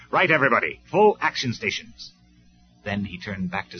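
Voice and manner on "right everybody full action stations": loud, higher battle order; commanding shout. Acted. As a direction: call the troops to stations. Commander calls his troops.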